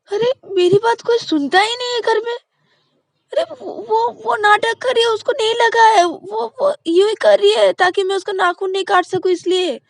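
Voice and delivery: nobody listens to me, on the verge of tears; crying protest. Acted. Ananya protesting to mom that Payal is faking, crying high wail.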